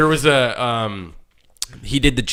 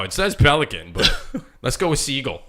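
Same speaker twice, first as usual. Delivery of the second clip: normal talking voice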